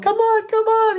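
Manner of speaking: put-on very high, squeaky little girl urging. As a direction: pipe it up like a small girl calling someone along. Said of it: "the girl's like".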